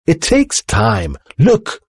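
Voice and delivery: slow insistence rising to a high cry; excitedly. A narrator's reading. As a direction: stretch it out, then exclaim high on the last word. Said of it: "he shouted excitedly".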